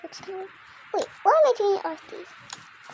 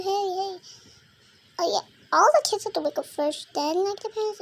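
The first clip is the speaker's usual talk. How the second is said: ordinary talk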